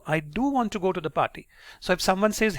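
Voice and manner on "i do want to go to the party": "do" stressed with raised pitch. Shown as a demonstration. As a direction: put the weight on "do". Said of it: "you stress on the word do".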